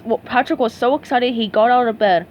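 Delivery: ordinary delivery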